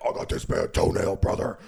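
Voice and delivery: raspy wrestler promo growl; Ultimate Warrior voice. Retold or imitated. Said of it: "I'm the Ultimate Warrior".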